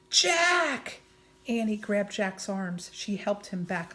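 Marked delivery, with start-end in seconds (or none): 0.1-1.0 loud urgent call; shout. Acted. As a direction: yell his name in alarm. Girl calling out.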